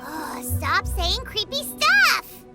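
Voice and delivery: fed-up, dragging out the words; exasperated protest. Acted. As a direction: plead crossly for the creepy talk to stop. Exasperated by creepy offer.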